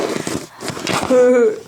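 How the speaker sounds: short put-on chuckle; mock laugh; acted laugh. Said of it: "all the engines were laughing".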